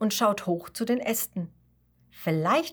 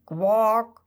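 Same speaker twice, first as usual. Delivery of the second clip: a loud croaking quack; frog quack; 3 semitones higher, 5 dB louder than usual. Acted. A frog croaks beside him.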